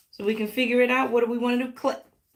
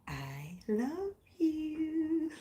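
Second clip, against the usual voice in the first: slow sung whisper; 4 semitones higher, 10 dB quieter, much slower than usual. Real, not acted. Whispering love to her viewers at sign-off. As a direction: breathe it tenderly to them.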